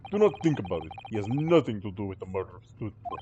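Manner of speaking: put-on Russian accent, brushing it off; dismissive Russian sailor. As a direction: wave it away like a Russian guard.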